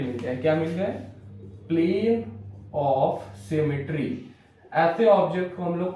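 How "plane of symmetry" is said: slow, spaced-out teacher repetition; slow key term. Real, not acted. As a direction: draw out each word for the class. Teacher slowly repeats key term. (धीरे-धीरे, रुक-रुक कर)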